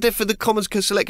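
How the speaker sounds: high, rushed, flustered excuse; panicked explanation. Retold or imitated. Comedian explaining himself to a cop, panicked and fast. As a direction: gabble an excuse in mock panic. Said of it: "I panicked and told the cop".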